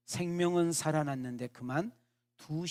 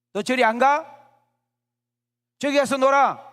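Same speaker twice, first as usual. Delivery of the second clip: much higher, much louder angry yelling; furious shout; 10 semitones higher, 16 dB louder than usual. Acted. Patient yells at the girl to go play elsewhere, furious shout. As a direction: blast the child away with your voice. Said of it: "하고 소리를 질렀어요".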